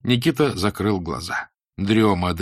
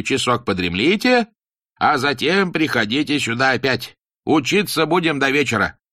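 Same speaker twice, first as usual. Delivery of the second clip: stern teacher voice; 5 semitones higher, 4 dB louder than usual. Acted. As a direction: preach it like a strict old teacher. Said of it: "Кузьма Демьяныч провозгласил".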